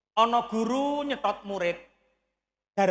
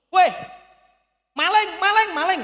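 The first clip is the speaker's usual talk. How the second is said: shrill alarmed yell of maling; shouting thief; 9 semitones higher, 7 dB louder than usual. Retold or imitated. Old farmer shouting at boys stealing cucumbers, loud alarmed yell.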